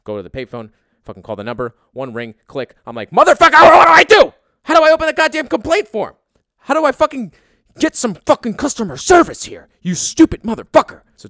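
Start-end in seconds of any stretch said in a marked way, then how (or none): 3.1-11.0 screaming at full force, much higher and louder; enraged yelling at the desk clerk; furious scream. Retold or imitated. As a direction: lose it completely at the agent. Screaming at airline agent.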